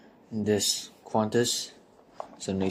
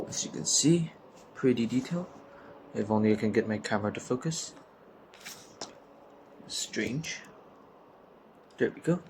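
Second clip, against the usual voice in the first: speaking through a sore throat; strained, scratchy sore throat voice; 4 dB quieter than usual. Real, not acted. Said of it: "I'm having a bit of a sore throat".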